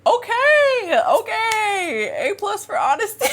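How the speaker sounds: high, hammed-up wail; mock tearful shout. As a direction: wail it in exaggerated hurt. Mock reaction to his brutal confession.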